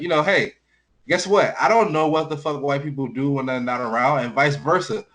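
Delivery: ordinary talk, nothing marked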